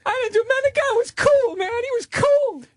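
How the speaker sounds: high, frantic pleading; hammed-up freak-out; mock panicked suspect. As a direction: squeak out the denial like a cornered man.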